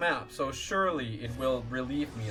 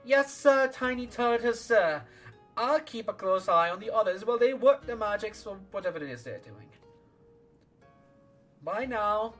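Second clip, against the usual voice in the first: put-on high, loud excited child; eager kid voice; 9 semitones higher, 4 dB louder than usual. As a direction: chirp it like an eager urchin. Voicing eager little urchin.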